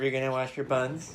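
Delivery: normal talking voice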